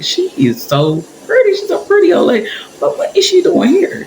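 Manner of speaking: high-pitched, puzzled inner voice; baffled admiration. Retold or imitated. Thinking to herself about the pretty old lady, baffled.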